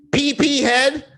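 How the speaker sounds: high, loud sing-song schoolyard jeer; mocking kid taunt. Middle school kids teasing him about his fade, high mocking jeer. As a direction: taunt like a bratty kid on the playground.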